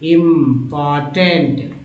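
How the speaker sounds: इम्पॉर्टेंट very slowly, split as im-por-tent; teacher sounding out इम्पॉर्टेंट; part-by-part reading. Shown as a demonstration. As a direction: break इम्पॉर्टेंट into stretched syllables. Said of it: "यहाँ टेंट देखिए टेंट".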